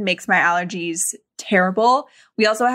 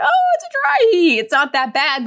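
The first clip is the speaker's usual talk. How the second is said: high, loud mocking dismissive voice; 9 semitones higher, 4 dB louder than usual. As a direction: mimic a know-it-all local, high and loud. Mocking what Arizonans always say.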